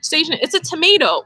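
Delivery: high, fast, excited retelling of her own reaction; rattling off why it's a bad space plant; excited self-quote. To the kids, retelling her own excited space-farming remark, high and fast. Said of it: "And I was like".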